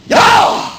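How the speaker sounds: explosive shout from deep in the body; yell from diaphragm. Shown as a demonstration. Instructor showing how to scream at an attacker, explosive yell. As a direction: let it jump out towards the attacker. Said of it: "It comes from the diaphragm".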